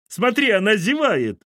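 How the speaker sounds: high, loud thrilled cry; excited yell. A narrator's reading. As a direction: cry out to the wife to look, thrilled. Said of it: "приглушённо завопил Кирин отец". (восторженный громкий возглас)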